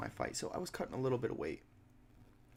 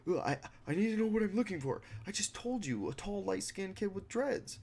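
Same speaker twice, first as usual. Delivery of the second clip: high, dopey mock voice of a useless desk clerk; mocking the office guy who can't help; clueless clerk impression; 9 semitones higher than usual. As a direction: play him dim and whiny, much higher.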